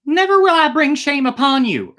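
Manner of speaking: high, loud pleading princess; urgent princess. A narrator's reading. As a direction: urge your father to let you go. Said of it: "urged the girl".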